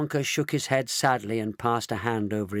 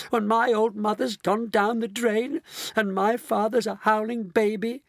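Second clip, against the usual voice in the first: high-pitched distressed protest; crying out; 11 semitones higher than usual. A narrator's reading. Mrs Bucket to Mister Wonka, distressed cry. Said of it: "cried poor Missus Bucket".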